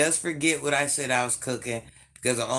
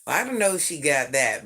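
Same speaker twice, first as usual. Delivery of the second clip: usual speaking voice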